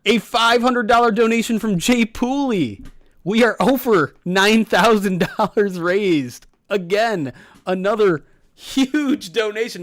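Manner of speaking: loud, slow, hyped donation call; excited announcement. Real, not acted.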